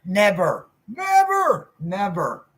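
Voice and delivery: second never leaps very high; mock-outraged triple never; repeated emphatic never. Real, not acted. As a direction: hammer each never, peak in the middle. Emphatic refusal.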